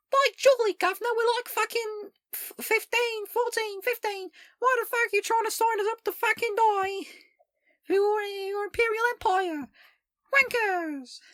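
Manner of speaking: very high, loud squeaky mock-Cockney; cartoonish child self. Streamer voicing their child self at army recruiters, squeaky outrage. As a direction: squeal it out like an indignant urchin. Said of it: "in my young, idiot, uh, so-, sock them child brain".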